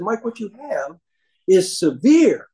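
"severe" drawn out slow and loud; stress on severe. Retold or imitated. Doctors lecturing young Mike, heavy slow stress on "severe". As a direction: lean hard and slow on "severe". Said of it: "emphasis on severe".

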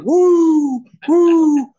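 high, loud wrestler "woo" yell; screaming like a wrestler; Ric Flair woo. Retold or imitated. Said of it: "and I was screaming".

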